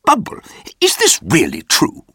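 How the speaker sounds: high-pitched shocked question; aghast, starting very high on the name; horrified. A narrator's reading. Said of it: "asked in horror".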